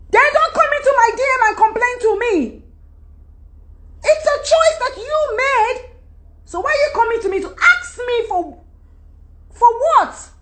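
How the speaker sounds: high, exasperated telling-off; fed up and incredulous, voice pitched high. Real, not acted. Venting at women in her DMs.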